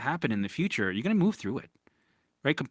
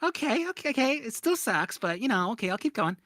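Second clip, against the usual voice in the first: higher voice of a resigned client; imitating a client; 8 semitones higher than usual. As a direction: play the client who gives in and keeps going. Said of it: "And they'd be like".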